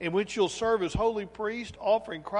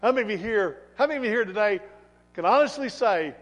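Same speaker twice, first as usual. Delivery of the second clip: loud, punchy challenge to the congregation; forceful preaching; 3 semitones higher, 9 dB louder than usual. Acted. Preacher asks congregation to admit their sins, forceful preaching. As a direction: preach it out loud, hitting key words hard.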